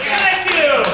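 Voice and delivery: loud, high shout over cheers; calling out thanks. Real, not acted. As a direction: belt out thanks to the crowd. Host calls over cheering audience.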